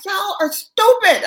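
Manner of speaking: loud, high, drawn-out holler; slow, high-pitched excited yell; retold scream. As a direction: scream it in amazement at the band. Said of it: "I screamed to the band".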